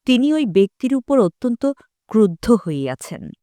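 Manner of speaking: ordinary talk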